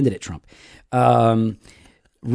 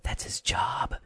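whispered, as if spelling out the obvious; pointed whisper; 15 dB quieter, much slower than usual. Acted. Host telling Moore's critics the obvious, pointed exasperated whisper. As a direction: whisper it pointedly to slow listeners.

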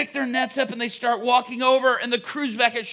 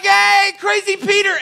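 higher, louder mocking jeer; loud jeering taunt; 9 semitones higher, 8 dB louder than usual. Shore crews mocking crazy Peter, loud jeer. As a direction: jeer at him for the whole beach to hear.

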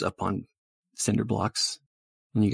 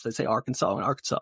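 ordinary talk, nothing marked

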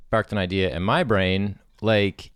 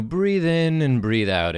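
higher, slow soothing app voice; meditation app voiceover; 8 semitones higher, 4 dB louder, slower than usual. Shown as a demonstration. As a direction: coax a listener to breathe, slowly. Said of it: "app voiceover".

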